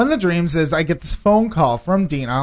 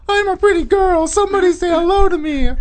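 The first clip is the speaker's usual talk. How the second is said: high, piercing mock whine; mocking co-host's diva plea; 4 dB louder than usual. Co-host mocking her wanting a hello, in high mimicry. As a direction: put on a squeaky, attention-hungry girl voice.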